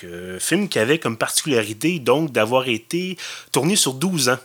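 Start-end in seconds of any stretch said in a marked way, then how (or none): none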